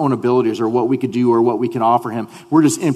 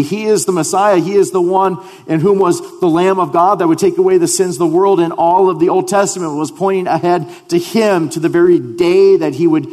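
louder, higher, building fervent proclamation; full-force preaching; 6 semitones higher, 4 dB louder than usual. Acted. Preacher to congregation, proclaiming Christ, loud and fervent. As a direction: preach it out to the congregation with rising passion.

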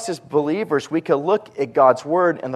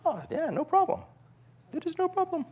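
put-on high, bright Sudanese-accented reply; 7 dB quieter than usual. Official agreeing to the halftime show, Sudanese accent. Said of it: "That's how they talk".

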